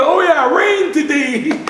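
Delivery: slow, high, sing-song promise of rain; cheerful old man. Acted. Old father promising rain to the women, slow and high. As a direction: draw it out like a happy old elder.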